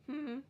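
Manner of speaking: a single wordless hummed beat; hummed syllable. Shown as a demonstration. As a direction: hum one syllable with lips closed. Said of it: "you can hum it".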